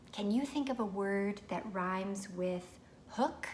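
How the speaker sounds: put-on slow, clear question to a child; slow teacher prompt. Shown as a demonstration. Parent asking a child slowly about rhymes. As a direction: ask it slowly and gently. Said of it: "Or you could say".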